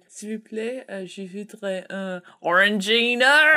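French spoken in a put-on Cornish accent; mangled French, Cornish ending. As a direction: order in French like a Cornish tourist. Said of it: "say it in a Cornish accent".

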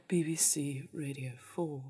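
low, soft Radio four male voice; imitation male continuity announcer. Shown as a demonstration. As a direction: drop low and hushed like a BBC man. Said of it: "do the male version".

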